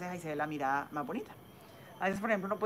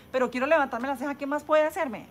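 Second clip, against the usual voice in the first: higher, louder imitation of a client; insistent patient; 8 semitones higher, 6 dB louder than usual. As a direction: act out a patient asking, pitched up. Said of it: "También hay gente que dice".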